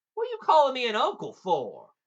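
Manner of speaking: loud, high, puzzled demand; indignant bafflement. A narrator's reading. Giant asking Jack about the holler, baffled.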